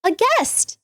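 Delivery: high, overly sweet welcoming voice; sweet hostess voice. Retold or imitated. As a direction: naive and cheerful, greeting a monster. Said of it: "She'd be like".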